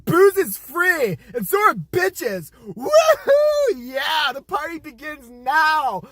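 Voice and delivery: slow, loud, high party drawl; party kid. Acted. Young president announcing the party, loud whoop. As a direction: chant it like a frat boy.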